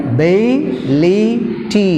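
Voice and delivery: Ability drawn out, stress on bi; slow model word. Shown as a demonstration. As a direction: stretch Ability slowly for the class. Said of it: "third syllable from the end".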